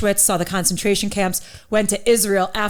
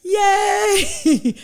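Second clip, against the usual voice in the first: mock enthusiasm; 10 semitones higher than usual. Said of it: "So I just was like".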